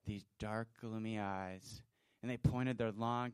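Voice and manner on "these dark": ordinary talk, nothing marked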